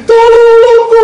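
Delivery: high, loud desperate cry; crying for help. Retold or imitated. Sister cries to the preacher, a high loud desperate plea. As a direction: scream for help through sobs. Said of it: "tangisan adik bungsu saya".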